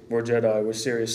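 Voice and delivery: mock-serious, low and understated; serious Jedi. Interviewer mimicking the other Star Wars characters, mock serious. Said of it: "most of the characters are like".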